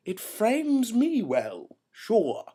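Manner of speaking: high, loud, drawn-out self-admiration; hammed-up vanity. Vain actor allowing others on stage, hammed-up self-regard. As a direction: revel grandly in being the star.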